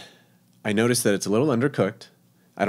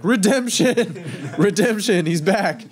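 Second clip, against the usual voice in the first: high, slow triumphant cheer; excited; 10 semitones higher, 3 dB louder, slower than usual. Retold or imitated. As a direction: announce it like a victory. Sous chef cheers him.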